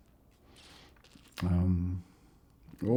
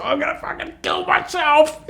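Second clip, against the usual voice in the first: much higher, louder, hammed-up despair; mock distraught rant; 6 dB louder than usual. Fan after Melbourne loses, hammed-up outburst. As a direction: play the gutted footy fan losing it. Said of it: "he went nuts".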